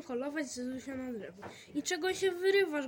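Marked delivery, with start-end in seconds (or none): 1.7-2.9 annoyed. A narrator's reading. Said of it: "zdenerwował się Staszek".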